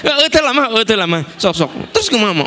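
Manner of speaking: teasing impression of a colleague's protest; mock Pak Kingking. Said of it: "Pak Kingking mah". (menirukan Pak Kingking menolak dengan suara tinggi)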